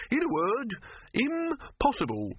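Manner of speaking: high, drawn-out exasperation; exasperated refusal. A narrator's reading. Silverfish turns down Dibbler's plan, exasperated. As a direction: refuse in a high, slow squeak.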